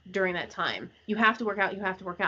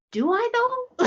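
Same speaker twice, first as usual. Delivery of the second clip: mock disbelief; 4 dB louder, slower than usual. Real, not acted. Mocking the have-to crowd.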